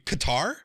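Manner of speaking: Qatar as kuh-TAR, questioning; "Qatar" like guitar with a K, as a query; corrected pronunciation. Shown as a demonstration. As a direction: say "Qatar" with the stress on TAR, rising. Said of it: "I think you said Qatar".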